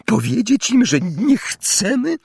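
high, hesitant anxious questions, partly whispered; trembling voice. A narrator's reading. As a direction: falter upward as you ask your husband. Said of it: "mówiła ciotka Petunia rozdygotanym głosem". (wysokie, lękliwe, niepewne pytania)

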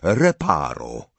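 slow, low, firm incantation; measured, decisive command. A narrator's reading. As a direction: pronounce the spell with calm authority. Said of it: "powiedział stanowczo".

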